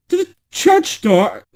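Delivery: high, slow mock surprise; surprised. False bride feigning surprise to the prince. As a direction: play dumb, pitch way up.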